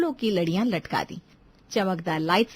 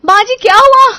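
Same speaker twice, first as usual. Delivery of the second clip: very loud, high panicked question; alarmed cry; 12 semitones higher, 19 dB louder than usual. Acted. Alarmed relative cries out. (घबराकर चीखते हुए)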